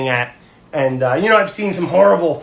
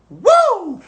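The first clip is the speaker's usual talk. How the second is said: excited woo; 4 dB louder than usual. Real, not acted. Cheering a friend's salmon catch.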